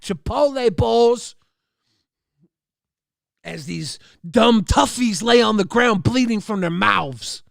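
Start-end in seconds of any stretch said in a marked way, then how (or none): none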